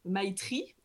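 slow, careful English with French accent; "My Tree" re-articulated with French-accented R; French-accented English retry. Real, not acted. Host retrying after apologising, careful French-accented English. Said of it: "pardon pour cet accent".

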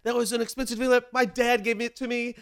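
high, loud mock rant; imitating angry partner. Imitating angry partner yelling over the thrown-out lamp, high and loud. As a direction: ham up his furious yelling.

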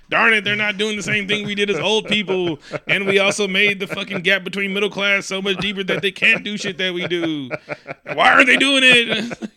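high, exasperated mock complaint; mock griping elder. Old people griping about millennials, hammed-up exasperation.

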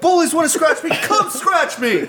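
high, loud, slow dare; laughing mock bravado; mock tough taunt. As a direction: taunt the bullies, dragging it out.

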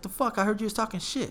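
high-pitched accusation; retold indignant confrontation. Friend confronts guy over talking behind his back, indignant.

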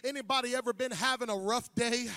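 ordinary delivery

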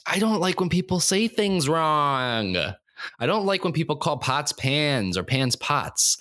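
high, dragged-out complaint; exasperated whine. Real, not acted. Airing what bothers them, exasperated and drawn out. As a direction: stretch each gripe out high and slow. Mock tantrum about pet peeve.

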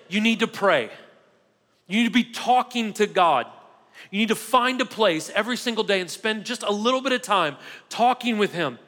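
ordinary talk, nothing marked

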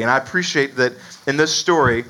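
usual speaking voice

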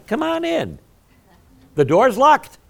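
loud, high preaching build; preaching at full force. Real, not acted. Pastor preaching loud to his congregation. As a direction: preach it up to the rafters.

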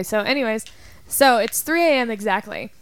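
normal talking voice

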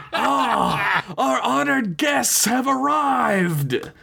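high, drawn-out theatrical proclamation; booming call-out. A narrator's reading. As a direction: hail the guests across a vast hall. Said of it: "called out, his voice echoing".

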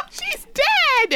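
very high squeal with a laugh; laugh, then squeal it in disbelief; high-pitched astonished outburst; incredulous squeal. Real, not acted.

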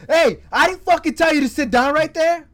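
loud, high, furious bark at a detainee; angry cop yelling. Retold or imitated. Cop yelling at a detainee who sat down, loud furious bark. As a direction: yell it like a cop who lost his temper.

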